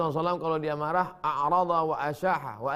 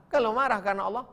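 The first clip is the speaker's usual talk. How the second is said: normal talking voice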